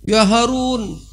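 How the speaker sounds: high shout calling down; shout from the tree. Retold or imitated. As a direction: yell it down from the treetop. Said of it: "Dari atas pohon dia teriak". (teriakan tinggi dari atas pohon)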